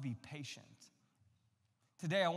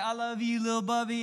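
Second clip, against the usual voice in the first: put-on high, sugary little-girl voice; sweet sister; 5 semitones higher, 6 dB louder than usual. Little girl cooing to her brother, high and sweet. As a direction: play the sweet big sister. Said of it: "And she's like".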